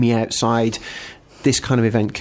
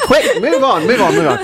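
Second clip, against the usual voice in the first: laughing; 7 semitones higher, slower than usual. Real, not acted. Laughing at studio embarrassment.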